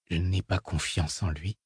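low, soft murmur. A narrator's reading. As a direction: murmur it under your breath. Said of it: "murmura-t-elle".

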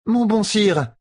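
high, sweet-talking merchant; Renel la marchande. Acted. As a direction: flatter him in a high voice. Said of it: "dit Renel". (voix aiguë et flatteuse de marchande)

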